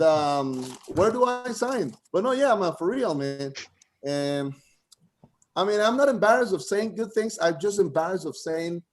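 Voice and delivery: ordinary talk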